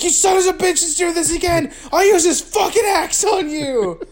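high, hammed-up threatening shout; comic high-pitched fury; mock angry dad. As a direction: yell a warning like an irate dad. Acts dad threatening the kids.

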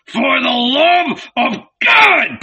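high, drawn-out exasperated cry; exasperated outburst; shouted. A narrator's reading. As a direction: shout it slowly, at the end of patience. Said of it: "he shouted".

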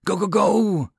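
high-pitched urgent charge order; yelling. A narrator's reading. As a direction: yell the squad forward. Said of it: "Teria yelled".

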